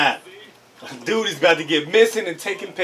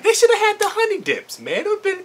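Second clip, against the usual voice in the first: higher joking whine; mock whine; 14 semitones higher, faster than usual. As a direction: complain in play.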